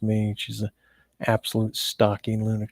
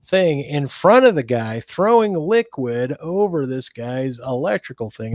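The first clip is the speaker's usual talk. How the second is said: ordinary talk, nothing marked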